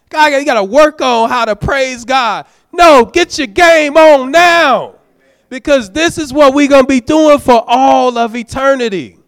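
high and loud, driving the point home; fervent preaching shout. Real, not acted. Preacher shouting at the congregation to praise now. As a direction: preach it out with full force.

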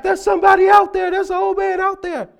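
high, fast mock panic; panicked. Big E pointing at the window, imitating frantic panic. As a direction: blurt it out breathless with fright. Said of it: "he was all in a panic".

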